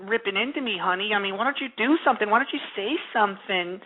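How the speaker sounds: lightly mocking whiny girlfriend; a hint of a whiny girlfriend. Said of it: "she's probably like".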